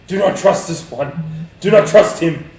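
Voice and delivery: high, forceful in-character warning; yelling. Acted. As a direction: yell it out over the others. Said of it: "I'm gonna yell".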